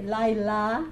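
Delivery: "Lai", pause, "La", each syllable on its own. Shown as a demonstration. Teacher going through the vowels for a student, "Lai, La" syllable by syllable. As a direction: softly sound out "Lai, La" in two separate pieces.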